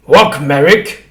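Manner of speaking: loud, slow angry order; harsh showman ordering the creature; angry command. A narrator's reading. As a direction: snap it at him like a master. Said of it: "said the shopkeeper angrily".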